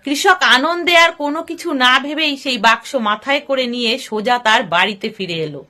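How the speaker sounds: plain reading voice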